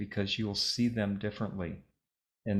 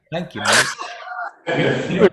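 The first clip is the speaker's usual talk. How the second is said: put-on loud, very slow copy of Dave's accent, mate drawn out; mimicking Dave's accent; 4 semitones higher, 8 dB louder, much slower than usual. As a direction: stretch out Thank you, mate in Dave's accent, teasing. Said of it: "I love it when you say that, Dave".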